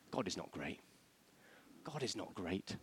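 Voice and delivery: low, soft insinuation; hushed claim. Acted. Envoy denying God's greatness to the people, hushed and low. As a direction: plant the doubt quietly.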